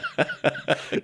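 a real burst of laughter, pitched high and soft. Real, not acted. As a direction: laugh freely at the punchline. Laughing at the cricket story.

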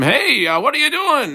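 imitated scream at restrained volume; mock scream. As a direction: call out in half-hearted alarm at a thief. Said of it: "and I scream".

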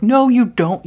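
a high, loud scolding no; scolding friend. Retold or imitated. Friend scolding drunk girl.

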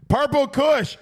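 loud, high announcer call; hyped shout-out. Acted. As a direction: call the name out like a hype man.

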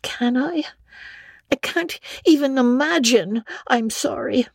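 put-on high girlish voice, stubborn yet sorry; a proud young girl who won't lie; Anne, defiant girl. A narrator's reading. Anne refusing Marilla's demand, high and stubborn.

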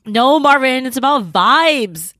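drawn-out, emphatic vibes; insistent. Real, not acted. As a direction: lean into it, slow and loud.

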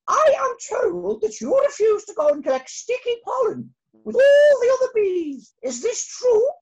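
very high, loud stern demand; a furious queen interrogating, shouted high; angry queen. Acted. Queen confronting Arthur.

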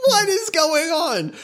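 high, laughing disbelief; mock panic while laughing. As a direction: squeak it out through laughter.

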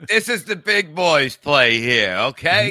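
put-on big-boy swagger, loud and slow; mock pep talk.

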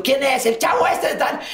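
preguntan a gritos, mucho más agudo y riendo; gritos entre risas. Retold or imitated. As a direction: imita el alboroto de los compañeros entre carcajadas. Compañeros gritando quién es. (gritos agudos entre risas)

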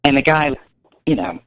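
usual speaking voice

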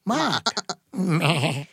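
mock Boston "Mark" with the R dropped; hammed-up Boston accent on the name; bad Boston accent. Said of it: "That accent".

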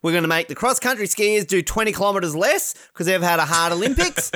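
hammed-up official ordering skiers out; bossy organisers. Organisers ordering freestyle skiers out, mock bossy. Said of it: "the organizers were finally like".

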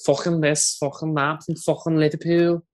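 high-pitched Scouse with throaty CK sounds; Liverpool accent. Retold or imitated. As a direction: exaggerate the Liverpool accent, scraping every "ck". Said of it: "my favorite part of it is the C K sound".

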